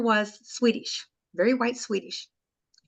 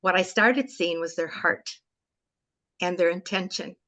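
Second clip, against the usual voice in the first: ordinary talk, nothing marked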